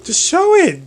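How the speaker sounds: high-pitched, exasperated encouragement; urging on. Retold or imitated. Friend urging a shy would-be model, high and insistent. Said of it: "And I'm like".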